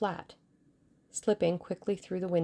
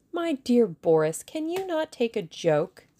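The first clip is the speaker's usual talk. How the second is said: high, slow teasing question; amused chiding; 6 semitones higher, 4 dB louder than usual. A narrator's reading. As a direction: drawl it up high, playfully reproachful. Woman teases the angry Russian.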